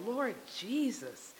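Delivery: very soft, slow retold plea; bothered inward prayer. Praying inside at the gas station, soft bothered plea. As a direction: send up a quiet, troubled prayer under it all. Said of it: "I'm really bothered inside".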